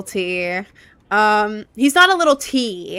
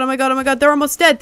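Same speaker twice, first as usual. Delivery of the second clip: ordinary delivery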